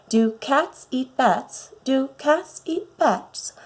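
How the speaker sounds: slow, soft and dreamy. A narrator's reading. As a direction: drift off sleepily as you ask. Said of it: "in a dreamy sort of way".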